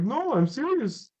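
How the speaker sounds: put-on high Russian kid accent, insisting. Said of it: "And he's like".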